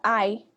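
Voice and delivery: "I" said like English "eye". Shown as a demonstration. Teacher recalling a rule to students, "I" pronounced "ai". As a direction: say "I" as the diphthong "ai". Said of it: "o i terminava a sílaba".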